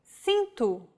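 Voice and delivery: cinto with an S sound; cinto, C read as S; Portuguese soft C. Shown as a demonstration. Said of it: "or like this".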